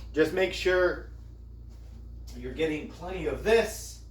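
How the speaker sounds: ordinary talk, nothing marked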